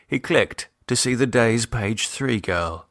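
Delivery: ordinary delivery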